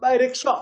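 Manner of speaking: "rickshaw" with a loud, weighted "shaw"; emphatic "by rickshaw", landing on "shaw"; stress on shaw. Shown as a demonstration. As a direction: push the last syllable of "rickshaw". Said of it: "shaw is being emphasized here".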